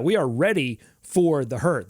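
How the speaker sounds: ordinary talk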